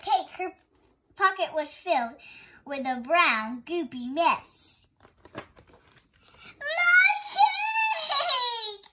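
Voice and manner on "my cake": high crying shout of loss; anguished wail. Acted. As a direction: wail over the ruined cake. Betty finds cake melted.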